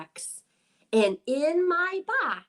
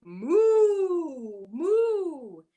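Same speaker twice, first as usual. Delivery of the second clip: drawn-out storybook moo; cow moo; 6 semitones higher, 5 dB louder than usual. A narrator's reading. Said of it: "The cow says".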